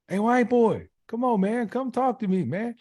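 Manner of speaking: very high, insistent mock holler; calling out. Inmate hounding his neighbor every couple hours, high pestering holler. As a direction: imitate a nagging neighbor yelling for attention. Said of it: "don't yell over here".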